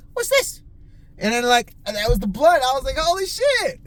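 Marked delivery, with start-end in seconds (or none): 0.1-0.6 sudden high squeak of surprise; high-pitched "huh, what's this"; surprised. Retold or imitated. Finding something under the console. 3.0-3.8 high-pitched excited exclamation; shocked delight, way up high; surprised. Retold or imitated. Finding the lost blunt.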